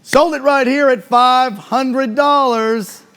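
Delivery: loud, slow sold announcement; auctioneer call. Real, not acted. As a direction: bang the gavel with your voice. Closing the sale.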